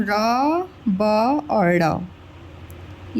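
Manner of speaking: each sound drawn out very slowly; slow syllable split. Shown as a demonstration. Teacher splits रबड़ for pupils, very slowly. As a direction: spell the letters apart one by one, very slowly. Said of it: "मैं इसका वर्ण विच्छेद करती हूँ".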